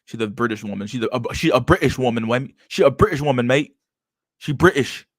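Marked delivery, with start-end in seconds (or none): 1.3-3.8 higher, louder put-on British accent; mock British accent. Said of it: "that British accent she had on". 4.4-5.0 high, loud fake British accent; mock British accent. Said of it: "that British accent she had on".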